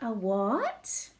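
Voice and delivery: usual speaking voice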